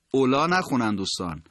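ordinary delivery

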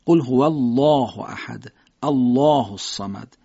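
slow recitation, thick dark l in الله; الله slow, full and emphatic; heavy lam. Shown as a demonstration. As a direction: gather the tongue for a heavy الله. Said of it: "تا لام درشت ادا بشه".

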